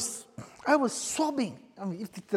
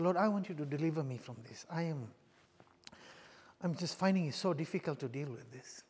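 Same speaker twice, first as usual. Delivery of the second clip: soft pleading prayer; 5 semitones lower, 9 dB quieter than usual. Retold or imitated. Retold prayer for deliverance.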